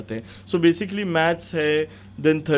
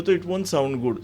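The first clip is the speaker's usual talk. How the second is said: ordinary talk, nothing marked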